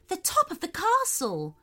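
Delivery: high, sudden excited call; sudden discovery. A narrator's reading. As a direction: point it out suddenly. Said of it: "said the mermaid suddenly".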